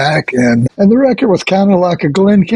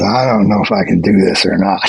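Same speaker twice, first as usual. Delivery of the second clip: low-pitched, self-doubting imitation; 8 semitones lower than usual. Musician imitates actor friend doubting himself, low and hesitant. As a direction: lightly mimic a friend doubting himself, voice dropped. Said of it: "he was always acting like he couldn't do anything".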